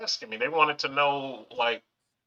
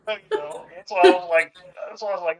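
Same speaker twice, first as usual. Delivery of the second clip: excited squeal; 8 semitones higher, 6 dB louder than usual. Real, not acted. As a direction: squeal it out in delight. Interviewer squeals, laughing reaction.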